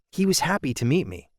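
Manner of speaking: plain reading voice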